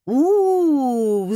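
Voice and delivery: loud, high, falling "oooh"; crowd howl. A narrator's reading. Audience jeering the wrong answer, howling. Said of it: "завыл народ".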